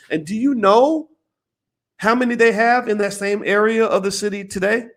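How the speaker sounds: normal talking voice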